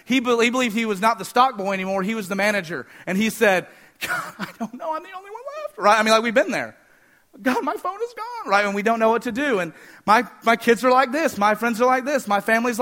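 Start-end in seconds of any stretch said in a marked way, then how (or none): 4.0-5.8 high, soft, whiny put-on voice with a laugh in it; mock whine, much higher and much softer; whiny mock complaint. Mocking Elijah's self-pity complaint. 7.4-8.5 high, thin whiny prayer voice; much higher whiny lament; mock whine. Mocking a petty prayer.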